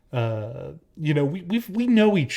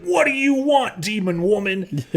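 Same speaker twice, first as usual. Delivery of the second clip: high, mock-outraged yelp; hammed-up hostility; 7 semitones higher than usual. Boyfriend as his girlfriend supposedly heard him, hammed-up hostile. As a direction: overact a nasty retort. Exaggerated false version of his words.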